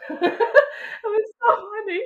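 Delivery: laughing through the words, slow; can barely get it out for laughing. Real, not acted. Mother recalling labor, real laugh.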